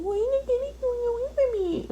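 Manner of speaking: put-on high, whimpering little girl voice, very high; crying little girl. Worker voicing the little girl tearing up, high and whiny. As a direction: mimic a tearful kid begging.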